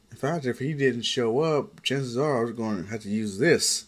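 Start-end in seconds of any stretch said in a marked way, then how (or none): none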